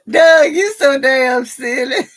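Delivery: loud, high, laughing exclamation. Real, not acted. Host cracking up at Doug's joke, laughing. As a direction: crack up loudly while saying it.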